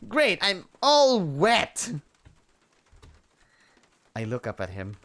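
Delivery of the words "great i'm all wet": high, loud, slow whine; exaggerated wet whine; squealing complaint. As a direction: wail about being soaked. Complaining after being soaked.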